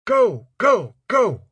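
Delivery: very high, full-throated shout; yelling. A narrator's reading. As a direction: scream the order to charge. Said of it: "Sergeant Carver was yelling".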